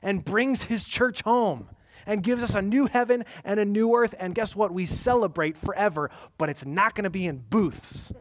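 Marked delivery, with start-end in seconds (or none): 0.0-6.1 high, loud preaching build to earth; rising preacher's cry; full-force preaching. Acted. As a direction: proclaim it like good news at the peak.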